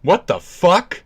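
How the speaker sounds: high, indignant outburst; loud, high-pitched mock offense; mock outrage. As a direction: cry out in put-on disbelief. Mock outrage at imagined cancellation.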